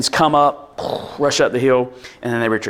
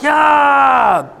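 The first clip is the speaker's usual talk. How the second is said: cheer; 13 semitones higher, 6 dB louder than usual. Retold or imitated. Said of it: "we're all like".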